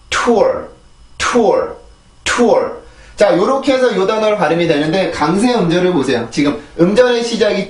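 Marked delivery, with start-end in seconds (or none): 0.1-2.8 tour with a strong aspirated T, falling, r at the end; English T. Shown as a demonstration. Teacher drilling learners on the word, English T. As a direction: hit tour as one stressed T-OOR. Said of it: "한 번 더".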